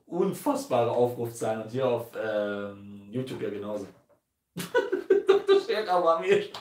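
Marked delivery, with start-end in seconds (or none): 4.6-6.5 lachend spöttisch. Real, not acted. Mocking title with laugh.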